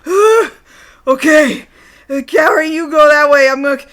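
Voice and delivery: loud, strained, halting groan-talk; mock winded pain. Ryo sending Kaori off after being kicked, mock winded pain. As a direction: force the words out through hammed-up pain. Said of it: "you hear him going".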